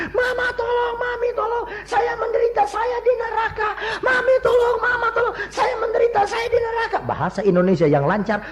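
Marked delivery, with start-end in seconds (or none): 0.1-7.0 very high pleading shriek in a put-on child's voice; imitated screaming child. Dead child calls to mother from hell through medium, screaming. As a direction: mimic a tormented child crying out for help. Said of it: "Menjerit-jerit".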